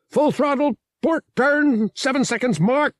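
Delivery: high, clipped alien pilot callouts; rapid-fire pilot. A narrator's reading. Celestin pilot giving Han rapid orders, high and quick. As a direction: bark quick orders over the radio. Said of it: "A voice spoke in rapid-fire Celestin".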